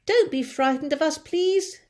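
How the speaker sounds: high, loud reassuring call. A narrator's reading. James calling down to the frightened men. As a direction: call down kindly to reassure them. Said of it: "James called out".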